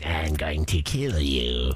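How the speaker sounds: low, slow menacing robot drone; creepy male robot voice. Retold or imitated. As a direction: threaten like a killer robot. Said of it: "male voice".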